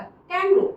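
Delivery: "कैंगरू" pitched high, as KANG-groo; corrected pronunciation. Shown as a demonstration. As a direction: model "कैंगरू" high, in two syllables. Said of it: "कैंगरू होता है इसका".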